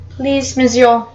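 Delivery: put-on French accent. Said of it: "I'm not French".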